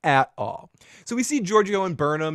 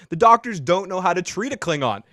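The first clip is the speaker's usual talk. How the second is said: ordinary talk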